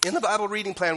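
ordinary talk, nothing marked

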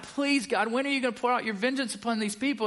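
high, fast, pleading; struggling church member crying out to God, high and hurried; desperate plea to God. Retold or imitated. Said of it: "you're crying out to God".

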